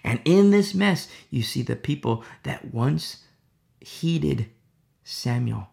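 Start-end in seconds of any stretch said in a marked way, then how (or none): none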